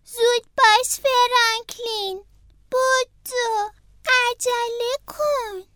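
the snail urging Franklin to run; urgent snail. Acted. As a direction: hurry him along.

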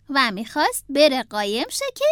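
ordinary delivery, nothing marked

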